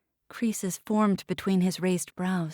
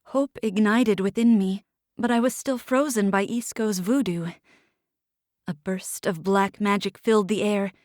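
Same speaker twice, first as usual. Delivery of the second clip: ordinary delivery, nothing marked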